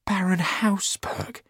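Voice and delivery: breathless, high gasp of a name; startled cry. A narrator's reading. Hughie cries out the Baron's name in alarm. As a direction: Hughie blurts the name in shock. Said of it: "cried Hughie".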